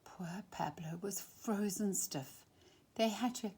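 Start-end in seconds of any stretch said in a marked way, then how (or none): 0.1-2.4 soft breathy whisper; whispered pity. A narrator's reading. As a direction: hush it like a sad secret. Pablo frozen after the fall.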